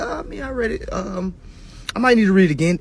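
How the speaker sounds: high, hesitant mock client; unsure client. Client admitting he needs to reread, high. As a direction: hedge like a caught client. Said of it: "folks say".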